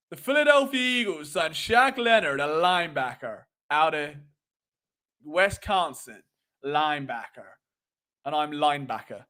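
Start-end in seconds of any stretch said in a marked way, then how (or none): 0.1-6.2 put-on American announcer, dropping low at the end; measured American sports-read attempt; American accent. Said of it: "do an American accent". 6.6-7.5 put-on low American-accented linebacker; attempted American accent. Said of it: "I can't say linebacker in American".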